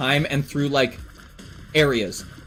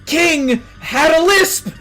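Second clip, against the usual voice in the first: laughing; 6 semitones higher than usual. Real, not acted. Laughing while telling the story.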